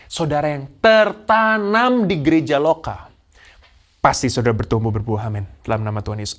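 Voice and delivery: normal talking voice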